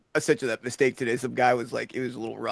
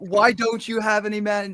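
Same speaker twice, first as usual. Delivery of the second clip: retold loud lady; 8 semitones higher, 5 dB louder than usual. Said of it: "she's like".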